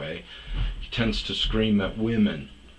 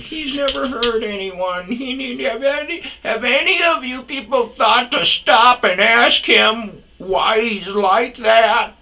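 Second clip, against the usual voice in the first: much higher, louder, slow indignant squeal; mock outraged defender; 11 dB louder, slower than usual. Mocking a commenter defending the street shouter, in a much higher, louder, indignant squeal. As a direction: shriek it like a scandalized online defender. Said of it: "Other people are like".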